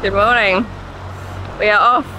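normal talking voice

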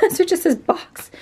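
high laughing voice. Real, not acted. Laughing to viewers about the stuck box label, amused. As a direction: say it through a real laugh.

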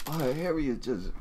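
ordinary talk, nothing marked

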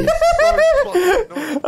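high, shrieking laugh after a fright; real shocked laugh bursting out. Real, not acted. Startled by a sudden scare.

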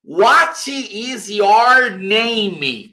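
What is your name, drawn out slowly; very slow classroom model; very slow demo. Shown as a demonstration. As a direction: spell it out slowly for beginners. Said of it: "aquela que eu falo na primeira aula".

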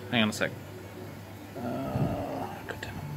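whispered curse under breath; whispering. Real, not acted. Phone chimes mid-recording, annoyed.

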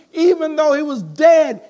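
sudden loud high burst on the word dead; preaching shout. Real, not acted. Preacher reading John eleven to the church, shouting. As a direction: throw the line out to the congregation.

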